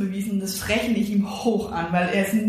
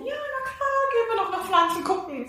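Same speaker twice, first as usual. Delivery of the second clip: much higher, put-on sunny agreement; fake cheerful; 12 semitones higher than usual. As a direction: fake a breezy yes while hiding panic. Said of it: "Sondern ich musste natürlich sagen". (gespielt fröhlich, viel höher)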